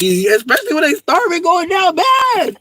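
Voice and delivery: high-pitched shouted rant; worked-up, laughing outburst; comic exclamation. Real, not acted. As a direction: let it climb into a high yell.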